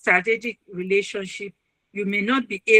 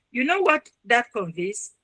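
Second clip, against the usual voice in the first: ordinary talk, nothing marked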